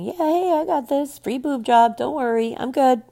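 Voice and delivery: high, chirpy put-on cheer; smiling bravado. Patient waving bye to loved ones before surgery, mock-cheerful. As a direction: wave off worry with a fake-breezy high voice. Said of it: "Me with a smile on my face".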